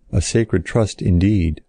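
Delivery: ordinary delivery, nothing marked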